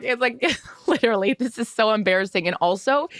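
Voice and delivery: normal talking voice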